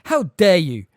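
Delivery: loud, outraged cry while running; furious shout. A narrator's reading. McGonagall running at Hagrid's attackers, furious shout. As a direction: shout at the attackers as you charge. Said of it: "the figure shouted as she ran".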